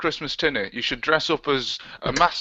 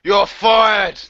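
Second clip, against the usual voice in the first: mock boss; 4 semitones higher, 7 dB louder than usual. Mock firing a host.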